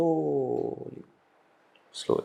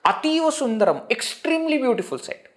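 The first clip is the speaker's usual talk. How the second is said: mock awe; 8 semitones higher than usual. Shown as a demonstration. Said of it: "Ativa means extreme".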